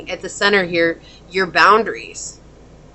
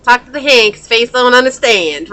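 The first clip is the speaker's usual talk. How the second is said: louder, sassy "talk to the hand"; sassy brush-off; 4 semitones higher, 6 dB louder than usual. Retold or imitated. Reader voicing the client rejecting a suitor, louder and sassy. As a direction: hold up a hand and sass them. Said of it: "Y'all remember that?".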